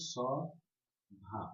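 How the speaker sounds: সভা split into two slow beats; syllable by syllable. Shown as a demonstration. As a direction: draw apart the syllables of সভা. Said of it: "কি উচ্চারণ করলাম?".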